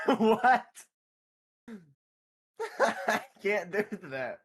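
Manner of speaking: laughing in disbelief. Real, not acted. Laughing at a friend's absurd request, giggly. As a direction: giggle through the refusal.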